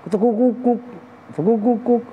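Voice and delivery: high cooing turtledove call, a different rhythm; other dove cooing. Retold or imitated. Preacher imitating the second dove's different coo. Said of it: "Yang satu".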